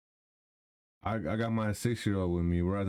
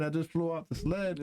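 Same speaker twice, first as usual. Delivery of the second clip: normal talking voice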